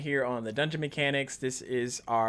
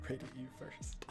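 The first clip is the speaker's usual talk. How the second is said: soft unvoiced whisper with a laugh; 16 dB quieter, faster than usual. Real, not acted. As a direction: whisper it with a chuckle.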